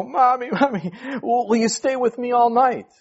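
put-on high, scared little boy pleading; frightened child begging mom to stay; trembling child's voice. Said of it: "asked in this trembling voice".

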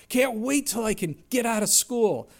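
playing an impatient young person; eager kid voice. Preacher imitating an impatient youngster, eager.

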